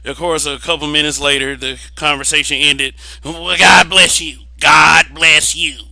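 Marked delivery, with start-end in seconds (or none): 3.2-5.8 slow, drawn-out mock blessing, louder; a hammed-up pious brush-off. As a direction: stretch out each God bless you dismissively. Mocking a dismissive church-lady blessing.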